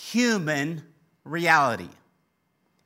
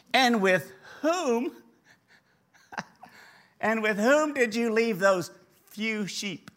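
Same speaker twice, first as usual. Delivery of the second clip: mock-incredulous big brother, pitched up; acted sarcasm, slow and higher; sarcastic; 6 semitones higher than usual. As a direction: draw out WHOM, high and scornful, with a laughing break. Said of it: "you can hear the sarcasm in his voice".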